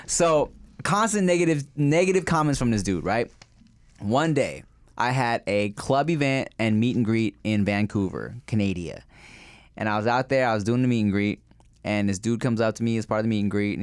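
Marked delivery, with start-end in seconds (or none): none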